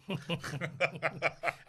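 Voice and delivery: real quiet laughter; chuckle. Real, not acted. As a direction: laugh softly along with the joke. Laughing after the icebreaker remark.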